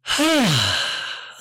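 long falling sigh of relief; relieved sigh. Retold or imitated. Friends learn the growl was a puppy, relieved sigh. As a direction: let all the fear out in one breath. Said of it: "we all just went".

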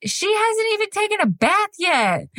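high, amused retort; high-pitched mock-patient dad; husband's reply. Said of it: "Tyler goes".

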